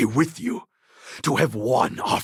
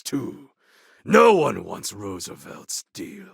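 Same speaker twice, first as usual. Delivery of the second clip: ordinary talk, nothing marked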